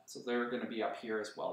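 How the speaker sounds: ordinary talk, nothing marked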